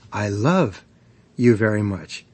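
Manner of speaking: "love" leaned on, then a pause; stress on LOVE. Shown as a demonstration. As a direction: hit "love", pause, then "you very much". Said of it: "putting the emphasis on any one".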